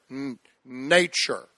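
slow, deliberate "nature"; slow teaching. Shown as a demonstration. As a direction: spell it out slowly for them. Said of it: "say the word".

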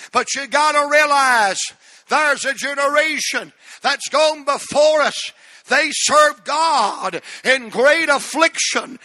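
building, high preacher's cry; preaching. Real, not acted. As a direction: preach it with rising force. Preaches at rising force.

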